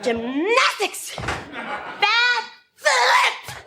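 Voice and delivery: high yelled announcement; excited shout. Acted. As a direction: shout each move out. Shouts while doing gymnastics.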